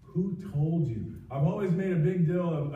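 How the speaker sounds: normal talking voice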